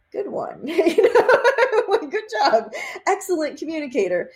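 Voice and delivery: high, giggling praise for spirit; laughing praise. Retold or imitated. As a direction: laugh through the compliment, delighted. Cheering spirit's clever message.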